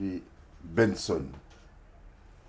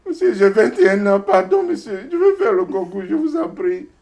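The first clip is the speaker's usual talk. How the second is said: much higher and louder, pleading hard; begging young man; 10 semitones higher, 7 dB louder than usual. Retold or imitated. Young man begging the registration clerk, high and loud pleading. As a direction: beg the clerk with a high, straining voice.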